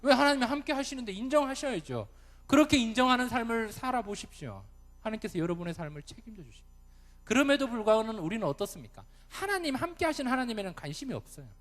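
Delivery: loud, high, driving sermon delivery; full-force preaching. Real, not acted. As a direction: hammer the point home to the congregation.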